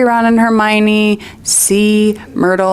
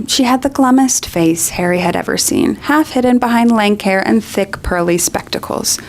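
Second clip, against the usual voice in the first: usual speaking voice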